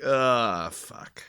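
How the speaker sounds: ordinary delivery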